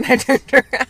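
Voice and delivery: laughing. Real, not acted. Laughs at her own story.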